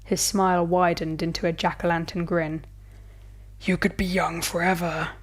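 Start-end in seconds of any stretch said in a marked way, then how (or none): 3.6-5.1 rasped. A narrator's reading. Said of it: "he rasped".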